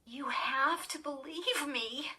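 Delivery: slow, pleading, high plea; trembling plea. Acted. Girl begging her sister to believe her, slow and high. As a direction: beg to be believed. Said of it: "cried in a trembling voice".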